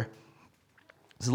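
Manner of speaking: ordinary talk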